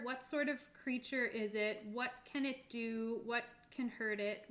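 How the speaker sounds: ordinary talk, nothing marked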